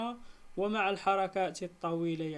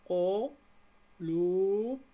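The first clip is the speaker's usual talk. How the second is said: each syllable stretched slowly and apart; drawn out syllable by syllable for pupils; slow syllable split; 4 dB louder, much slower than usual. Shown as a demonstration. Teacher to pupils, splitting the word, slow and drawn out. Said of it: "نجزئها".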